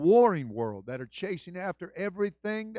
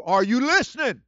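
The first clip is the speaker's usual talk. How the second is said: raised, louder call to the room; 8 semitones higher, 11 dB louder than usual. Real, not acted. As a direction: call out the question to wake them up. Preacher calls out to congregation.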